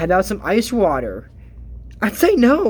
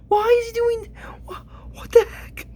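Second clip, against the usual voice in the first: disbelief. Real, not acted. Baffled at Bailiwick sweeping forest.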